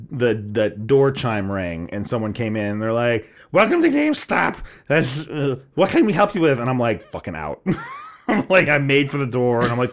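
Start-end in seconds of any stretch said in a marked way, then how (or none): 3.5-6.6 high, bright welcome; chirpy store greeter. Retold or imitated. Staff greeting a new customer. 7.0-7.6 quiet. Retold or imitated. Fed up, leaving the store.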